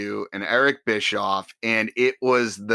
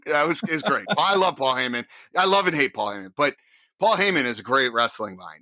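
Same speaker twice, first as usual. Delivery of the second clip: ordinary talk, nothing marked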